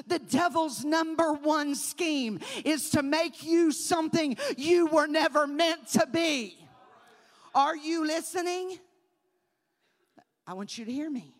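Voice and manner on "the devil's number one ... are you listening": loud, driving sermon; loud preacher declaring; full-force preaching. Acted.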